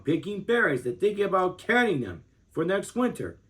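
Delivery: ordinary delivery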